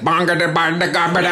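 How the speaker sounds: clumsy imitation of the soothing noise; failed copycat babble. Nanny trying comedian's noise on baby, clumsy babble. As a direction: babble nonsense syllables, badly copying someone's baby trick. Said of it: "she tries to do my stuff".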